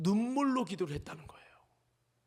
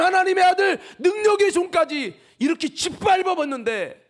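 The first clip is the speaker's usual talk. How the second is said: high, loud thundering sermon; preacher thundering about the green tree; fiery preaching; 11 semitones higher, 11 dB louder than usual. Acted. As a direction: proclaim it to the rafters. Preaching at full force.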